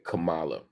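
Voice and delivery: Kamala with the stress moved to MAH; mispronounced name. Retold or imitated. Host quotes the campaign's version, Kamala mispronounced as kuh-MAH-luh. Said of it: "His campaign keeps saying".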